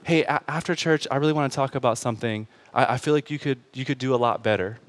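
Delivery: a soft, hushed imitation of a wife; imitating wife's whisper. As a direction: murmur it low like a wife after church. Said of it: "leaned over and whispered".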